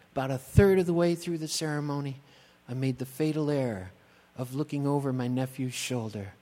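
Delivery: ordinary talk, nothing marked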